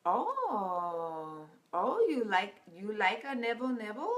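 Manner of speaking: lightly voiced older Indian counselor, teasing; a hint of the older Indian gentleman, playful; imitating the counselor. Mimicking the counselor asking the couple about intimacy, light teasing voice. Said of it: "he says".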